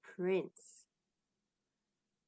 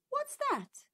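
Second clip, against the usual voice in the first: queen startled. Acted. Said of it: "said the queen".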